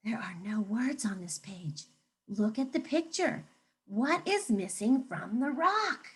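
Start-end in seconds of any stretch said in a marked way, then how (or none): 0.0-1.8 hushed whisper to the class; a mysterious whisper. Shown as a demonstration. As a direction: whisper like a secret.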